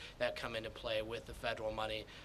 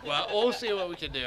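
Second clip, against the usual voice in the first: laughing; 7 semitones higher, 6 dB louder than usual. Real, not acted. Laughing off the crowd's jab.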